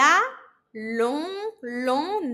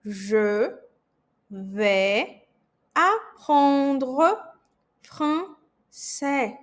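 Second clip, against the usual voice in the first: "Je vais apprendre français" palavra por palavra, com pausas; slow, word by word; much slower than usual. Shown as a demonstration. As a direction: diga devagar para o aluno repetir. (bem pausadamente, palavra por palavra)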